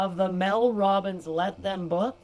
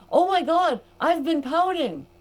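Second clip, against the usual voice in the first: high, louder gasp of discovery; imitating a surprised client; 9 semitones higher, 5 dB louder than usual. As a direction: play the client's sudden realization, up high. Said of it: "came into the group and said".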